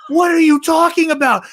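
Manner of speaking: high, loud disbelieving shout; incredulous Dooku. Retold or imitated. Dooku to Palpatine, hammed-up high incredulous shout. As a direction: Dooku baffled, yelling back high. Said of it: "And Dooku's like".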